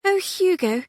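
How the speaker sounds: little sister hoping for riches; hopeful. A narrator's reading. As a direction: ask it full of hope. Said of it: "Gwendolen said hopefully".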